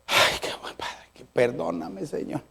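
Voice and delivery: very soft, half-whispered plea; acted murmur asking God's pardon; hushed penitent prayer. Acts a sheepish silent prayer.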